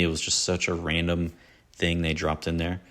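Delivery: ordinary talk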